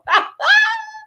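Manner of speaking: squealing laugh; high squeal of laughter. Real, not acted. Laughs at own forgetfulness.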